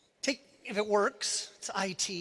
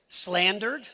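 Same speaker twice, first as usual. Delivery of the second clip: usual speaking voice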